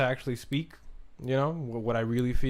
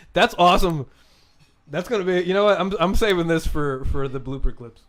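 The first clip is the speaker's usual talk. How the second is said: excited; 4 semitones higher, 7 dB louder than usual. Real, not acted. Laughing at his own slip.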